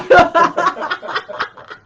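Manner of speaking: big delighted laugh; loud laugh. Real, not acted. Laughs with Sue.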